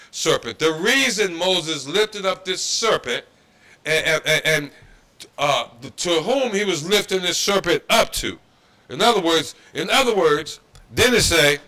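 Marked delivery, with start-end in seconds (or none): none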